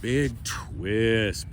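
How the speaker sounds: normal talking voice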